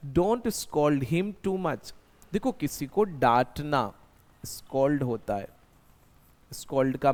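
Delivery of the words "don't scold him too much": very slow, each word laid out for the class; slow model sentence. Shown as a demonstration. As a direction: dictate it slowly like a teacher. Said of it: "पढ़ना बंद कर देगा". (बहुत धीरे, साफ़-साफ़)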